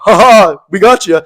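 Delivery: hammed-up Nickelodeon gotcha; mock sitcom gloat. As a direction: gloat like a kids-TV prankster. Mocking Nickelodeon sitcom moment.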